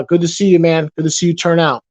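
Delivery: ordinary talk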